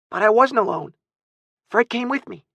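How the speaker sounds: put-on very high, protesting child; little boy. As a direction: argue back as a small boy. Small boy argues back.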